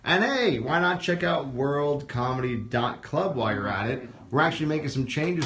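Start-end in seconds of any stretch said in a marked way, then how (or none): 0.0-3.3 big, drawn-out emcee delivery; show announcer. Real, not acted. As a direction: announce the act with a stretched-out name. Host announces act and show.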